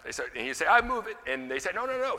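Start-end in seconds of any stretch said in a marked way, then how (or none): 0.6-1.2 high mock-Polish accent; high-pitched Polish-accented offer. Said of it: "in his Polish accent". 1.7-2.2 high-pitched retold objection; high run of no's; mock protest. Retold lifters stopping the janitor.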